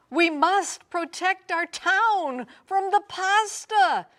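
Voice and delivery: high, loud commanding shout; rally the town to defend; mayor shouting orders. A narrator's reading. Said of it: "shouted the mayor".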